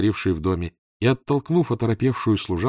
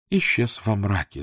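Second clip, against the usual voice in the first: ordinary delivery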